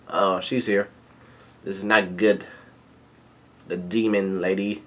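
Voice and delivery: low, soft voice, lightly acted foreboding; hushed dread. Lookout reports the demon lady, softly acted dread. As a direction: drop down softly as if bad news arrived.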